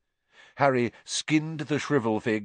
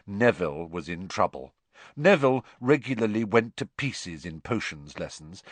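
ordinary delivery

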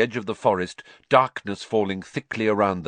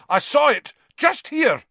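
very high, loud outburst; furious; 7 dB louder than usual. A narrator's reading. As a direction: insist in a fury. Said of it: "said the executioner furiously".

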